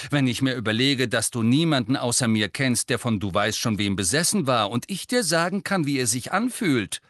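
zornig. A narrator's reading. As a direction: drive each point home angrily. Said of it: "sagte Ginny zornig".

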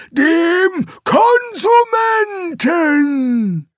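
high, very slow shouted recital; strained demon. Acted. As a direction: grind out the hated words. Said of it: "jedes Wort herauszuwürgen".

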